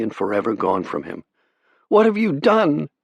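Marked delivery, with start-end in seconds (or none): 1.9-2.9 horrified demand. A narrator's reading. Pilot shocked at stowaway sister.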